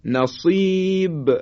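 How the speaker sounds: نصیب high and drawn out, ب bounced; slow recitation of نصیب with echoing ب; qalqalah on final ba. Shown as a demonstration. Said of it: "حرف با".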